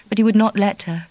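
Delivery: plain reading voice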